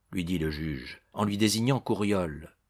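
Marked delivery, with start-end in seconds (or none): none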